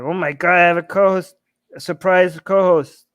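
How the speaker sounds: ordinary talk, nothing marked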